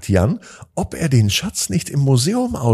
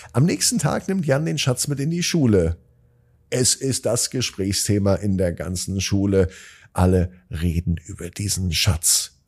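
ordinary delivery

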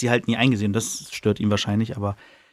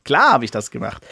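lightly voiced proud knight, tossing it off; a hint of a smug knight bragging; proud boast. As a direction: boast a little, pleased with himself. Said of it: "Voll stolz so".

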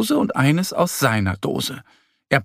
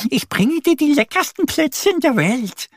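much higher eager little duck. Acted. Annie offering Mo her cookies, high proud duck voice. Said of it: "kichert Annie".

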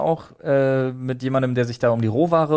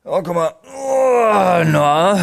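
high, louder groaning as if heaving something heavy; old man's straining groan; 6 semitones higher, 5 dB louder, much slower than usual. Retold or imitated. Mimicking an old man hauling up a flour sack, straining groan. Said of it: "Das ist ein alter Mann, der versucht, so einen Sack Mehl hochzuwuchten".

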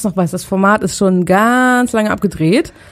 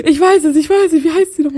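higher, eager half-sung guess; mock eager guessing; 8 semitones higher than usual. Host teasing co-host's face while guessing, mock eager. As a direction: act the excited know-it-all. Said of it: "wie man immer in deinem Gesicht sieht".

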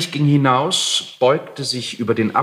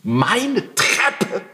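outraged cry; 7 semitones higher, slower than usual. A narrator's reading. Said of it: "schrie der Alte".

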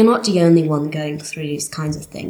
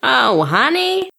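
sassy drawn-out honey; 5 semitones higher, slower than usual. Acted. Sassy reply about boyfriend.

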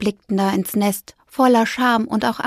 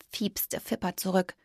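whisper; 11 dB quieter than usual. A narrator's reading. Narration whispered.

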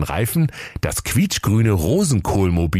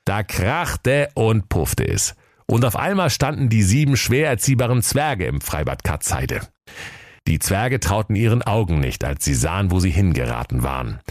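usual speaking voice

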